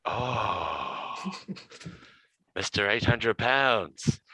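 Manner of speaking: a lightly put-on Serbian accent, slow and amused; mock Serbian guard. Serbian guard laughing, nicknaming Hamish in a Serbian accent. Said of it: "with a real heavy, Serbian accent".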